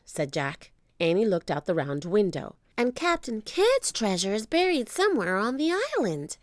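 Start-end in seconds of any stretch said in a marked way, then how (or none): 2.8-6.4 put-on high little girl voice; an eager kid announcing a discovery; young girl. Annie tells Jack about the treasure, high and eager.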